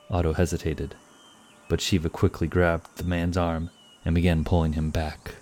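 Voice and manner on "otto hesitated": usual speaking voice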